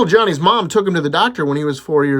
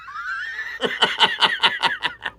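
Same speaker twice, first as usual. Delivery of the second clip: high laugh; 4 dB quieter than usual. Real, not acted. Laughs at own punchline.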